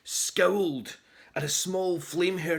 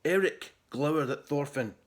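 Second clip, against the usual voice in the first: ordinary delivery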